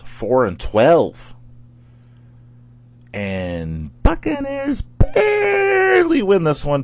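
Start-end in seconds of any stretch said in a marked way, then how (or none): 3.1-6.8 high, drawn-out excited yell; excited shout. Real, not acted. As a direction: stretch it out in a high cry. Excited call of close win.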